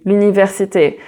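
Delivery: usual speaking voice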